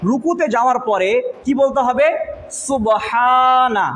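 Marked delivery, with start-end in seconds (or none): 2.6-3.9 সুবহানা drawn out very slowly; slow, careful সুবহানা for students to repeat; slow recitation. Shown as a demonstration. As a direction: stretch every sound of সুবহানা. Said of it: "বলো আমার সাথে".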